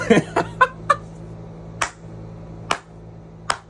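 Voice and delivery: high, breathless unvoiced laughter; wheezing laugh that will not stop; laughing fit. Real, not acted. Cracking up telling friends the story, high wheezing laughter.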